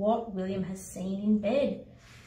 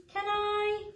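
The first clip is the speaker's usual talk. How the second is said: put-on little child voice; 11 semitones higher, 4 dB quieter than usual. Child pleading.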